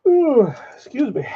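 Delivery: words stretched through a yawn. Real, not acted. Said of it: "I'm yawning".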